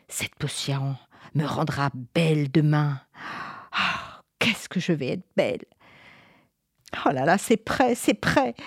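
lightly voiced witch, low then rising glee; the witch dreams of beauty aloud; witch musing. Witch cooking her potion, pleased with herself.

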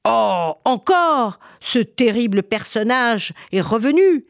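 high, loud, drawn-out dismay; exasperated king. Acted. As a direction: react in outraged disbelief. King hears the monster is back.

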